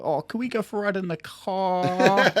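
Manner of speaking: put-on copying a little boy's American accent; American-tinged kid accent. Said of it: "kind of American".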